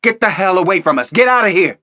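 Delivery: furious high shout; yelled. A narrator's reading. Detective yells at the FBI agents, furious shout. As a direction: lose it and yell them back. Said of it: "I lost it and yelled at them".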